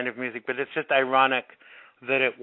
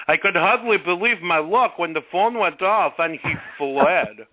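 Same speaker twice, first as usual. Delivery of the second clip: quoting a fisherman in a hammed-up Russian accent, higher; 4 semitones higher than usual. Radio host voicing a Russian fisherman's quote in mock Russian accent. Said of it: "That was my Russian accent".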